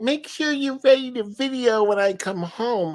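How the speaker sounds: high, lilting imitation of his wife; wife's voice. Husband quoting his wife's instructions, high mock voice. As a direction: mimic the wife's chirpy orders. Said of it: "she's like".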